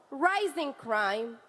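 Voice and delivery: ordinary talk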